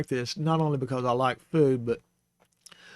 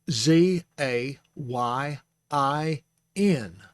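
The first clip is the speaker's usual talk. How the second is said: Z A Y I N spelled slowly letter by letter; much slower than usual. Shown as a demonstration. Teacher spelling the word out slowly for the class. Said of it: "Zayin is spelled".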